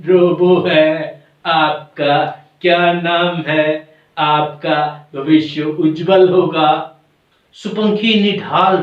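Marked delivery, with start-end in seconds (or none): 0.0-7.0 very slow, evenly spaced robotic greeting; stilted, drawn-out robot monotone. Acted. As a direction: recite it like a programmed machine. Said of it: "robo कहने लगता है".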